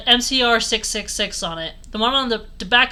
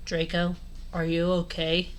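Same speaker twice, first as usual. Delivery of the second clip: low, soft gloomy goth deadpan; a hint of a brooding goth; gothic voice; 4 semitones lower, 8 dB quieter than usual. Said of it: "I asked in a gothic voice".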